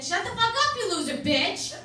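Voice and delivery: hammed-up angry yell at the daughter; mock yell. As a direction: snap it at her full volume. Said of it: "if you yell".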